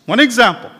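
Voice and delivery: normal talking voice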